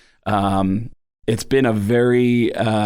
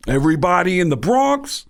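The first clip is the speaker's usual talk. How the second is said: Tracy Morgan; 5 semitones higher than usual. Acted. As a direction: draw it out in a put-on Bronx voice. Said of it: "sounds like Tracy Morgan".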